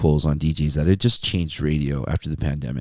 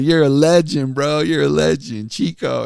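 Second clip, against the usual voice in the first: higher, louder teasing imitation; mock admiration; 8 semitones higher, 3 dB louder than usual. Coworkers make fun of the radio host, mock admiration. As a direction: tease with over-hyped praise. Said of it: "my coworkers make fun of me".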